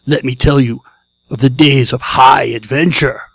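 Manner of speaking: loud booming epic narrator; Mako impression. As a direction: boom it out like the Conan movie's narrator. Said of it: "In your best Mako voice, read the following".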